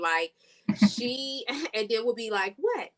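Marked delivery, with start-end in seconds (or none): 2.6-2.9 high squeaked what; a stunned, squeaky what; shrieked in disbelief; mock shocked what. Mock reaction to Jamala.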